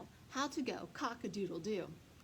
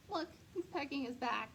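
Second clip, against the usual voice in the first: usual speaking voice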